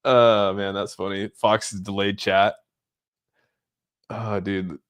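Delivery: usual speaking voice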